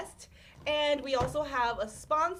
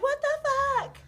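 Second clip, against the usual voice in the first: high, loud mock disbelief; hammed-up squeal of disbelief; imitated shocked customer; 6 dB louder than usual. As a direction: play a newly sober customer's outraged shock. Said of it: "they're sober, and they're like".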